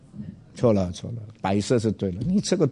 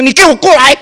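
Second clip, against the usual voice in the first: much higher, much louder angry command; retold furious shout; 17 dB louder than usual. Pastor shouting at rude policeman to come over, furious yell. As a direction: bark it like you're dressing him down. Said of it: "我生气".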